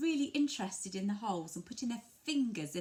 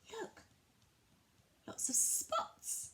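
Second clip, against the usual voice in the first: put-on modelling for a child; 14 semitones higher, much slower than usual. Shown as a demonstration. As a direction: point and coo at the picture for a toddler. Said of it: "look at the first page together and say".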